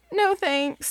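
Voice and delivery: high little goat refusing; a polite but firm little no; Gregory the goat. Acted. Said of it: "said Gregory".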